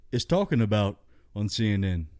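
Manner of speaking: normal talking voice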